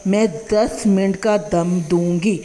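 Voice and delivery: usual speaking voice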